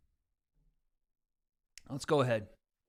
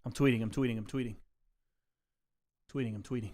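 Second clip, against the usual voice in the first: glitchy robot mic effect; 6 semitones lower, 7 dB quieter, faster than usual. Real, not acted. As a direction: voice warped by the mixer. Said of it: "it does the robot voice".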